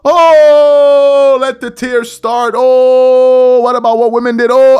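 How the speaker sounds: loud, wailing mock lament; loud mock lament for a fired commentator; mocking mourner. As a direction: ham up a crying fan's sorrow. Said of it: "Doris Burke being let go of".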